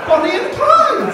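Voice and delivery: high, loud punchline; spring the reveal loudly; triumphant reveal. Acted.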